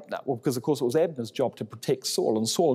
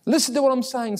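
higher, urgent call; 9 semitones higher, faster than usual. Acted. David calls down to Saul's army, high and urgent. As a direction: make them listen from afar.

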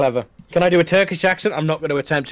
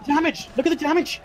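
excited; 11 semitones higher, faster than usual. Real, not acted. Caster hyped by big hit.

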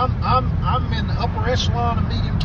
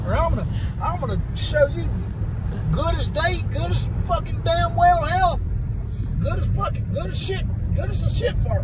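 cowboy voice; 6 semitones higher than usual. Acted. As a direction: ham up an ornery old cowboy threatening his buddy. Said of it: "talk in the cowboy voice".

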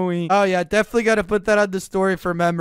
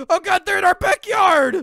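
frightened; 8 semitones higher than usual. Acted. Sees monsters in yard.

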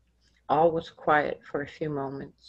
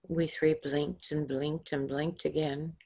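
ordinary delivery

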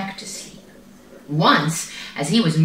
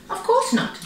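high, breezy little cub; 11 semitones higher than usual. A narrator's reading. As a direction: answer high and carefree. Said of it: "he answered".

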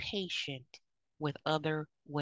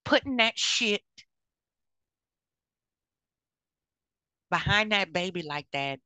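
put-on raised, louder scolding of a young mother; scolding yell; 4 semitones higher, 7 dB louder than usual. Auntie scolding a young mom caught shoplifting, loud and sharp. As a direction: call her out sharply over what she's doing. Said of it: "and be like".

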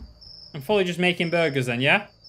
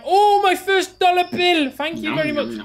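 much higher, excited squeal of thanks; first dollar joy; 13 semitones higher, 4 dB louder than usual. Acted. Worker thanking customer for first dollar, high excited squeal. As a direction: gush over the first dollar.

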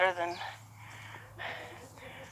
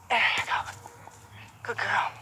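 whispered encouragement; 3 semitones higher, 7 dB quieter than usual. Real, not acted. Coaxing horse quietly.

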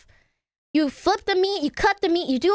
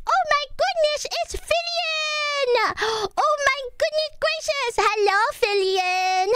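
high, drawn-out delighted squeal; excited greeting; 10 semitones higher, slower than usual. Real, not acted. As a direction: gush a welcome, stretching the names. Greeting a streamer's arriving raid.

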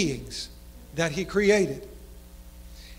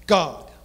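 "God." set apart, punched louder and alone; emphasis on God; 6 semitones lower, 4 dB louder than usual. Shown as a demonstration. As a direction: hit the single word "God." hard. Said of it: "put the emphasis on each word".

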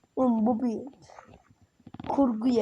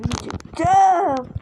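loud, high, drawn-out outburst; frustrated shout; 6 semitones higher, 7 dB louder than usual. Real, not acted. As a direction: burst out loudly, stretching the word. Frustrated outburst during game. (sinirle uzatarak bağırma)

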